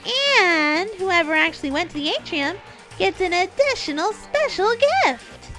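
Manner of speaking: high, loud, hyped announcer voice; fighting game announcer. Acted. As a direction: boom it out like a show host. Announcer voice in story.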